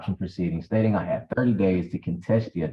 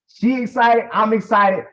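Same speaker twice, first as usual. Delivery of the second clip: excited; 9 semitones higher, 5 dB louder than usual. Retold or imitated. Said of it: "I'm like".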